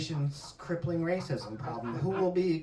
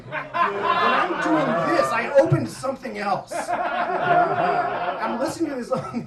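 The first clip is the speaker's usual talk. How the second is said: laughing; 6 semitones higher, 8 dB louder than usual. Real, not acted. Laughing while telling.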